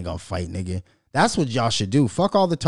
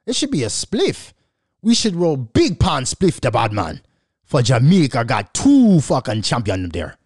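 mock Jamaican; 4 dB louder than usual. Celebrating Jamaican champions.

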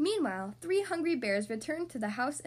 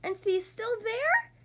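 very high, slow, astonished cub; high childish bear, amazed; Baby Bear squeak; 9 semitones higher, 4 dB louder, slower than usual. A narrator's reading. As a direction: squeak it slowly, rising in disbelief. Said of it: "squeaked Baby Bear".

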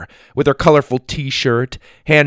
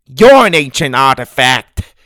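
loud, raised retort; bickering comeback; 4 semitones higher, 8 dB louder than usual. A narrator's reading. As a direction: hurl the insult straight back. Grandma throws insult back.

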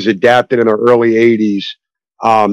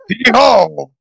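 Al Pacino impression; 8 semitones higher, slower than usual. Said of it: "Justin loves my Al Pacino impression".

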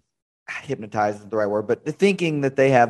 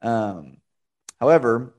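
ordinary talk, nothing marked

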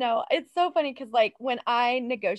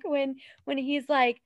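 normal talking voice

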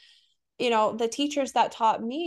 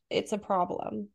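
ordinary delivery

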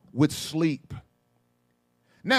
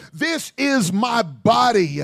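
slow solemn quote; slower than usual. Retold or imitated. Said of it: "he uses this language".

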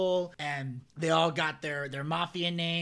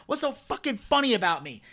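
higher, louder mock-angry wiseguy; Joe Pesci; 5 semitones higher, 5 dB louder than usual. Storyteller plays Pesci confronting Henry, raised mock-angry impression. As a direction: imitate an offended mobster demanding an answer. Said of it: "he gets so fucking mad".

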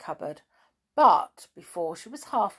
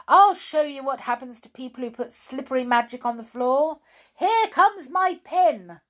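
angry threat; 7 semitones higher, 7 dB louder than usual. Acted. Amelia Jane threatening the toys with her pin, angry.

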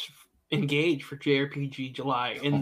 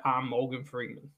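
Morgan Freeman voice; 3 semitones lower, 7 dB quieter than usual. Retold or imitated. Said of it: "a southern accent with a lisp".

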